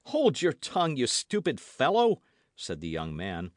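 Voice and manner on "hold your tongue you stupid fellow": D'Artagnan hushing the frightened lackey sharply; sharp command. A narrator's reading. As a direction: shut him up fast. Silencing a panicking servant.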